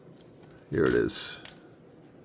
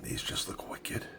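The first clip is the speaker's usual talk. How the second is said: breathy whispered awe over the cover; 13 dB quieter than usual. Real, not acted. Collector admiring his Freddy comic, whispered. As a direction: hush it like a secret.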